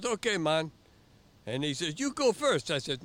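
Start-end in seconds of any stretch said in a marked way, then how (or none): 0.1-0.7 high, loud island voice pointing out the buoy; much higher, louder mock island guy; Jamaican instructor. Instructor accepts. 2.0-2.7 high, loud island voice pointing out the buoy; much higher, louder mock island guy; Jamaican instructor. Instructor yields start.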